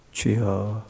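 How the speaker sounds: a low, soft groan of "주여"; low prayerful sigh; low sighing plea. Real, not acted. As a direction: sigh it out to the Lord. Preacher sighs out to the Lord.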